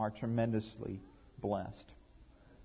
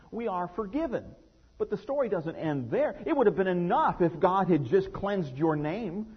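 higher, louder preacher's build; impassioned preaching; 5 semitones higher, 6 dB louder than usual. Real, not acted. Pastor stirring the congregation, preaching at full force. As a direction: preach it, climbing to the peak.

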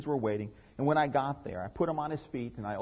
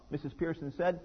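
usual speaking voice

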